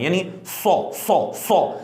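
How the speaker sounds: "سو" repeated with a full, heavy whistle; full whistle demo. Shown as a demonstration. Teacher showing students the full whistle, repeated "سو". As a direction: whistle "سو سو سو" with teeth together. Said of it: "پر سیٹی کے ساتھ ادا کرنا ہے".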